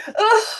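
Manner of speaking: high loud ugh of hurt; pained groan. Retold or imitated. Absorbing someone's hateful words, a high loud pained ugh. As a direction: grunt as if struck by the words. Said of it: "I'm taking in".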